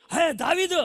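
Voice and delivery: high, startled shout of a name; startled call. Acted. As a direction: jump up and call him out in shock. Saul startled, calls David. (ఉలిక్కిపడి, ఎత్తైన స్వరంతో పిలుపు)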